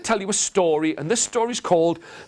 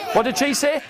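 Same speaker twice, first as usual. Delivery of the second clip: normal talking voice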